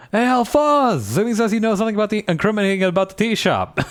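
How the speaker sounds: high-pitched comic holler; yelling. Acted. Player calls the foreman over about the tea shop, yelling.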